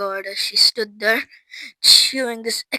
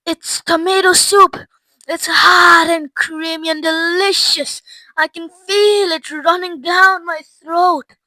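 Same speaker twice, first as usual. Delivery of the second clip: higher, delighted tasting voice; relishing delight; 6 semitones higher, 5 dB louder than usual. A narrator's reading. Violet tasting soup from the gum, relishing it. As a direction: savor it with drawn-out wonder.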